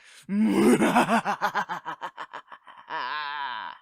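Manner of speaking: loud triumphant villain cackle; evil laugh. Acted. Ends villain monologue with laugh.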